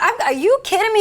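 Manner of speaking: high, loud retold disbelief; incredulous. Officer answering the suspect's compliment, incredulous. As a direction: shoot it back in a high, loud voice.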